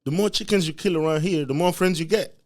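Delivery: put-on high, loud, eager kid voice; wide-eyed realization. Young boy seeing dad praised, high and eager. Kid's inner voice, excited.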